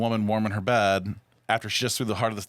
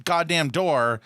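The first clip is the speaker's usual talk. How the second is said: ordinary talk, nothing marked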